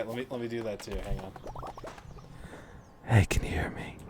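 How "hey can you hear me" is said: creepy raspy whisper; breathy menacing whisper; serial killer voice. Acted. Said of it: "activated the serial killer voice".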